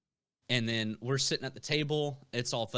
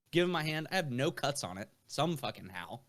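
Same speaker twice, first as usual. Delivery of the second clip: ordinary delivery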